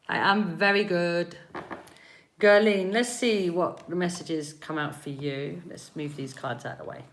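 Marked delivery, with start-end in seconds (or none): none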